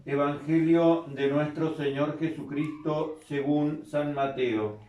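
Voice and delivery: soft, measured scripture reading; liturgical reading. Acted. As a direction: read it like a priest at mass. Announces the gospel.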